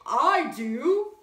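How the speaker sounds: loud bold knight; a stagey heroic shout; knight's cry. A narrator's reading. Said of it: "cried the knight".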